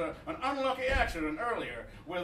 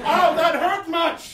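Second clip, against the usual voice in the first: mock pain cry; 8 semitones higher, 8 dB louder, slower than usual. Mock pain in improv scene.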